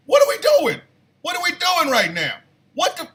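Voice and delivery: high-pitched comic protest; loud, acted-out bafflement; exasperated outburst. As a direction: squeal it in disbelief at his wife. His exasperated reply to wife.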